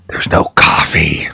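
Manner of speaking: mock-tragic whisper about the coffee. Real, not acted. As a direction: whisper it like bad news. Sad punchline about no coffee.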